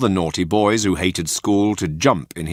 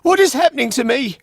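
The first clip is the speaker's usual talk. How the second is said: panic; 4 dB louder, faster than usual. Acted. Panicked puppet.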